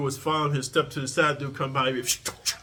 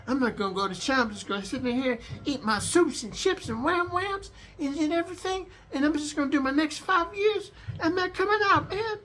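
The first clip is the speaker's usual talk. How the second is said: much higher, frightened whine; scared whiny inmate; 14 semitones higher than usual. Retold or imitated. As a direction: hide in the cell, pleading. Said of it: "you're all fucking scared".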